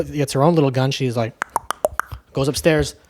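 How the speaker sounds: normal talking voice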